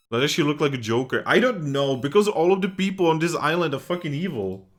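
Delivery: loud mock outrage; exasperated rant. Real, not acted. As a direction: vent at the evil islanders. Streamer rants at game characters.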